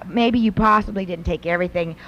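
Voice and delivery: usual speaking voice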